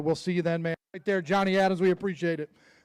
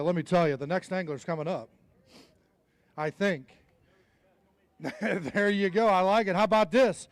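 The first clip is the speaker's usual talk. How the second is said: ordinary talk, nothing marked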